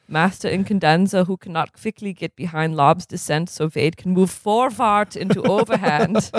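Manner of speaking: lightly put-on German accent, formal and measured; a stern German coach scolding in a mild German accent. Stitt lecturing sluggish players at dawn drills, mild German accent. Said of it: "do the accent again".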